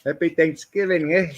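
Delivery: playful Canadian holiday greeting; mock Canadian accent. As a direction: tease the Canadian "eh". Joking about Canadian "eh.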